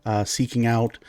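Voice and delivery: usual speaking voice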